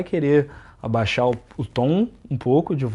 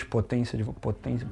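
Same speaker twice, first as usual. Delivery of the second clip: much softer, dropped almost to nothing; quiet take; 3 semitones lower, 10 dB quieter than usual. Shown as a demonstration. Host showing his cohost a low-power take of potência. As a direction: pull the power right down on potência. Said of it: "Potência de voz".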